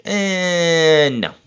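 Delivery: long, unimpressed "ehhh", then a short no; dismissive rejection. Retold or imitated. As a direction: buzz it away like a wrong answer. Rejects the wrestler's boast.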